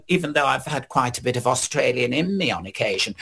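usual speaking voice

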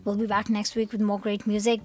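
normal talking voice

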